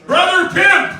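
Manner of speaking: high, loud shouted name; booming announcement. Pretend. Introducing the Brother Pimp to the crowd, shouted high and loud. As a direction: belt it like a hype man.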